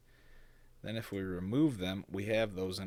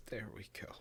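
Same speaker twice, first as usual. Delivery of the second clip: whispered; 2 semitones higher, 8 dB quieter than usual. Real, not acted. Muttering to himself at screen.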